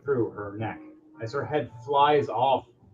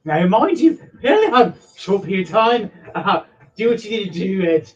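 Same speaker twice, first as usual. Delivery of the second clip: urgent NPC voice; 5 semitones higher, 4 dB louder than usual. Acted. GM voices NPC mid-battle.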